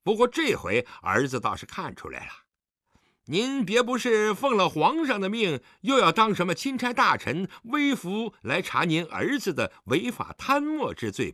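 high-pitched, insolent retorts; mocking, provocative indignation; defiant sarcasm. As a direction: throw the blame back at your father. Son sarcastically argues back.

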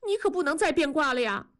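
quick, high-pitched insistence; wheedling demand. A narrator's reading. Wife stops crying and holds husband to it, insistent. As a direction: press him for a promise, high and quick.

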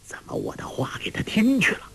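quick, startled whisper; hushed, hurried aside; whispered inner thought. A narrator's reading. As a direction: whisper it to yourself in alarm. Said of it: "心说".

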